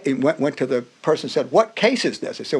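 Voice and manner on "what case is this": high, sharp judge's demand; stern judge. Retold or imitated. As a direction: bark it from the bench.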